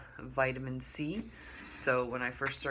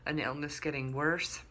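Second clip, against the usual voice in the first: ordinary talk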